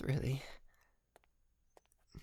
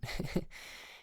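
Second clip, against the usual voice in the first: breathy laugh. Acted. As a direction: let out an airy chuckle. Joking about asking chauffeur.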